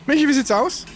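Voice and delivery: calling across the flat for news. Real, not acted. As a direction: shout the question over to her. Calling partner about the fix.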